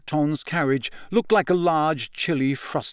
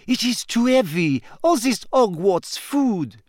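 put-on high-pitched French-accented grumbling; petulant French girl, drawn out at the end; grumpy; 8 semitones higher than usual. A narrator's reading. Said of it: "they heard her saying grumpily".

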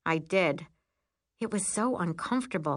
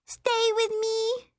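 put-on pleading little girl; 3 dB louder, slower than usual. Scared child clings to sister.

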